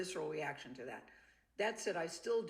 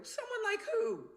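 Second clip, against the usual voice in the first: incredulous; 4 dB louder than usual. Retold or imitated. Oblivious wife retelling confrontation.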